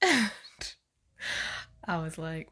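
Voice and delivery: laughing through the words; real laugh while telling; chuckling at the memory. Real, not acted.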